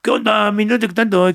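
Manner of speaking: put-on barrio Chilango accent. Quoting the character who talked like this, light barrio Chilango accent. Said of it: "que el personaje hablaba así".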